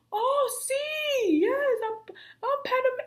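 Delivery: very high-pitched, eager squeal of recognition; imitating an excited woman. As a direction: mimic her thrilled reply way up high. Voices the woman's delighted answer.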